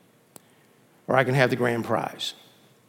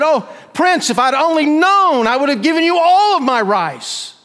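loud, high, anguished shout; calling out; 13 semitones higher, 8 dB louder than usual. Acted. Beggar calling after the departing prince, regretful shout. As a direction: cry out after someone riding away. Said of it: "out of shouting distance".

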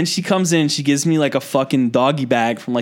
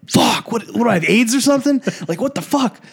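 high-pitched, exasperated freak-out; comic retelling of alarmed disbelief; retold panic; 10 semitones higher than usual. Retold panic at clinic news.

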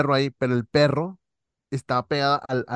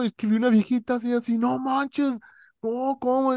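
put-on much higher, startled child retelling; scared kid's voice; 11 semitones higher than usual. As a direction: squeak it out like a spooked kid. Scared boy explains the sighting.

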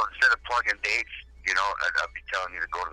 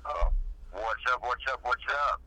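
over the phone; 4 semitones higher, 5 dB quieter than usual. Real, not acted. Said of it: "I'm on the phone right now with".

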